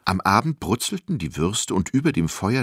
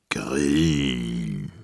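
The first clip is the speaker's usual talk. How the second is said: low, drawn-out giant voice; giant savouring; 7 semitones lower than usual. A narrator's reading. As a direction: stretch the word out longingly, licking your lips. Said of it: "wiederholte Simon und schleckte sich".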